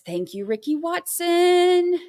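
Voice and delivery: high, drawn-out, bright thank-you; excited thanks. Real, not acted. Streamer thanking Ricky Watson for gifted memberships, gushing. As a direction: sing out the thanks to the donor.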